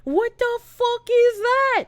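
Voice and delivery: high, drawn-out incredulous cry. Retold or imitated. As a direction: stretch it out in mock horror. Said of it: "they're like".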